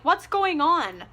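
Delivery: high, slow fake innocence; mock innocent question. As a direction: play dumb slowly. Acting Hardin's innocent question.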